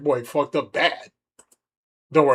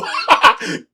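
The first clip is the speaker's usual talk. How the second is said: loud burst of laughter; 11 semitones higher, 8 dB louder than usual. Real, not acted. Laughs at own slip.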